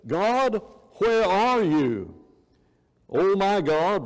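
anguished slow cry of where are you. Acted. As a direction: call out to God in distress. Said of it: "David cries out and says".

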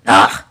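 loud, low groan of disgust; frustrated groan. Acted. Troll fed up with the keyboard, loud low groan.

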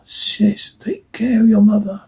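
urgent whisper. Acted. Father telling his daughter to protect her mother, whispered. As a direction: breathe the order so nothing hears. Danger approaching, hushing family.

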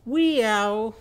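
loud, high, drawn-out meow; cat meow. Shown as a demonstration. Teacher answering as Winky Walrus's cat, loud high meow. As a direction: yowl it like a cat, high and falling. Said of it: "what's Winky Walrus's cat going to say?".

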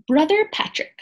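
ordinary delivery, nothing marked

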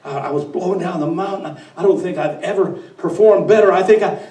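nervous underling babbling to the boss; flustered wind. Acted. The wind excuses itself to the devil, flustered and stammering.

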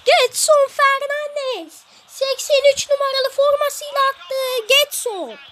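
high, loud goal call, stretched out; screaming football commentator. Acted.

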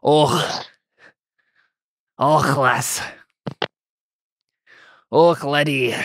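lightly voiced Scottish grunt then lass, laddie; a hint of Scottish burr on lass and laddie; Scottish accent. Shown as a demonstration. GM showing the player a Scottish accent, lightly done. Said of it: "that's the sound that gets you there".

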